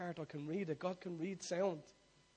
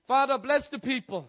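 fervent prayer shout; 10 semitones higher, 19 dB louder than usual. Acted. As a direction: shout the prayer high and loud. Call and response prayer.

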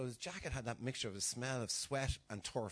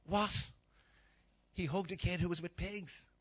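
scandalised gasp; 6 semitones higher, 3 dB louder than usual. Retold or imitated. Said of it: "the Pharisees going".

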